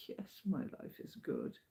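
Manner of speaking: hushed, satisfied whisper; contented whisper. Real, not acted. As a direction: breathe it out happily. Content moment in hotel room.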